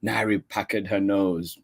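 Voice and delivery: usual speaking voice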